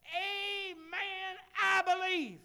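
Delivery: high, loud fervent agreement; loud amen. Retold or imitated. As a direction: cry it out in church fervour. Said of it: "she got loud".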